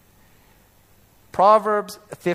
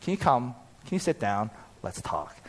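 softer, lower, calm invitation to talk; gentle; 4 semitones lower, 5 dB quieter than usual. Retold or imitated. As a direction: coax the son kindly, fading to near silence.

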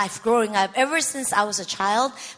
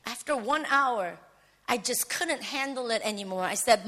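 ordinary talk

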